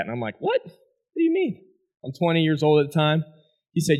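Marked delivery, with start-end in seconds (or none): 0.4-1.6 retelling a shocked question to the doctor; startled disbelief. Retold or imitated. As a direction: voice jumps high on mean.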